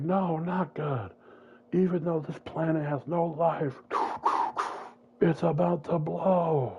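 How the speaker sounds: slow, high urgent warning; grave warning. Retold or imitated. Antonio warning the heroes, grave and urgent. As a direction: warn them the planet will blow.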